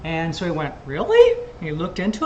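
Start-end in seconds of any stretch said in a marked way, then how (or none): 0.9-1.3 high, loud rising question; retold surprise. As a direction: mimic someone's startled double-take. Retold reaction to a discovery.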